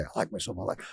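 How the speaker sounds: whispered I like myself under the breath; whispered to yourself. Shown as a demonstration. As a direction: whisper it to yourself passing a mirror.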